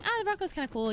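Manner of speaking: high, breathy-free surprised ah; impressed quote. Retold or imitated. Streamer noticing the new Bronco, pitched high. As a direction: perk up at the idea. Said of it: "and I was like".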